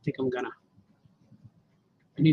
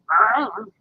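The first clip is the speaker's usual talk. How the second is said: put-on wolf boy growl; 4 semitones higher, 3 dB louder than usual.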